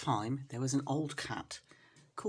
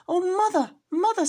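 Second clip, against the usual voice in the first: very high, loud crying out; a scared kitten tumbling from the jar; high squeaky cry; frightened kitten; 13 semitones higher, 8 dB louder than usual. Acted.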